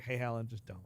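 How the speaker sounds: hushed aside. Retold or imitated. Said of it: "someone's like".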